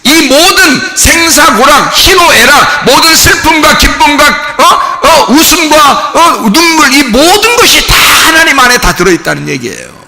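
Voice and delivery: fervent sermon voice; emphatic preaching. Real, not acted. Preaches with force.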